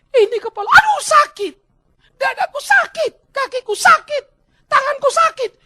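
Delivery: very high, loud yelps; hammed-up patient listing every hurt; yelling in pain. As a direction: wail out each ache at full voice. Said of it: "dia teriak-teriak sakit".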